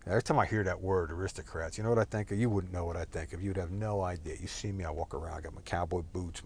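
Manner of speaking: ordinary talk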